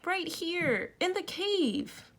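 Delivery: put-on high, slow little-girl voice from below; Denise's small voice. A narrator's reading. Trapped girl tells friends where she is, small high voice.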